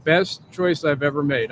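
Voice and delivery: ordinary delivery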